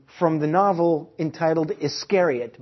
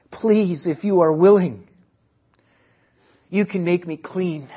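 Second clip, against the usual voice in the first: wailing plea; 4 semitones higher, 4 dB louder than usual. Acted. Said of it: "he wailed in a hoarse voice".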